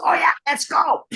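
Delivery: high, rowdy imitation; eager mock cheer. Husband eager to leave the studio, eager mock cheer. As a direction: mimic him piping up, raring to go.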